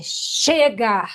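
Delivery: forceful, drawn-out cry; shout of release. Shown as a demonstration. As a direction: let the shout go fully. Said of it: "Dá o seu grito soltando". (grito solto, com força)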